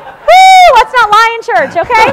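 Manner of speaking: loud high teasing exclamation, Woo jumping up; mock outrage. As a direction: playfully call out the lie. Joking at husband's remark, mock shock.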